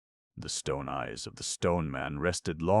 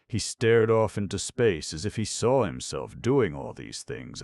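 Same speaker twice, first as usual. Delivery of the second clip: plain reading voice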